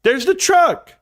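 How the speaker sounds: put-on a boy pointing out the stolen truck from the car; excited kid's call. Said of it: "I yell out".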